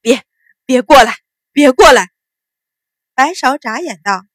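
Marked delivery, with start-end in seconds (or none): 0.0-2.1 terrified loud shout, stammered start; uncontrollable roar. Acted. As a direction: roar to keep someone away, much louder. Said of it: "忍不住大吼".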